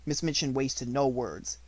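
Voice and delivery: ordinary delivery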